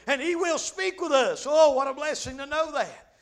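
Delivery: high, loud, fervent preacher; impassioned pulpit cry; full-force preaching. Real, not acted.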